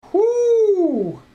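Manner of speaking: loud high-pitched whoo; high whoop. Real, not acted. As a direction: let out a whoop. Exclaims at thought.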